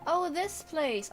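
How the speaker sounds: normal talking voice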